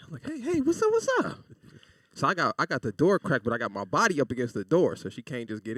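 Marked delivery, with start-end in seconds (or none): 0.3-1.4 much higher, panicky fake-casual greeting; high-pitched nervous hello; nervous squeaky hey. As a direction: squeak it out like you're caught.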